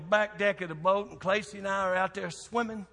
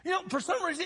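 ordinary talk, nothing marked

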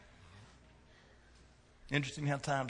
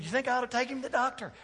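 high, fast mock-worried parent; 11 semitones higher, faster than usual. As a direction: ask it high and quick, hamming up the concern. Acts out worried parent's question.

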